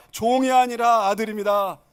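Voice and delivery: loud, declaimed slogan; congregational greeting. Shown as a demonstration. Preacher has neighbours greet each other, declaiming. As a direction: proclaim it for everyone to repeat. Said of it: "좌우 인사합시다".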